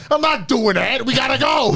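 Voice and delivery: hammed-up New Yorker yelling to leave; terrible New York accent. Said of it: "it's a terrible New York accent".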